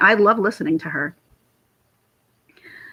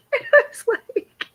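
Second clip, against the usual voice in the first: laughing; 14 semitones higher than usual. Real, not acted. Laughing at the memory.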